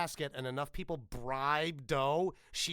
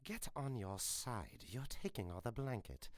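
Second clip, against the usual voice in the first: low grumbling voice; 3 semitones lower, 9 dB quieter than usual. Acted. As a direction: mutter it under the covers. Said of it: "It's like".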